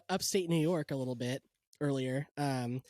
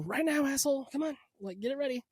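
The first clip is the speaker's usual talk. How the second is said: higher, pushy voice of a cue in the ear; mock in-ear cue voice; 10 semitones higher than usual. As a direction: hurry the performer along like a stage prompt. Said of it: "have something in my ears go".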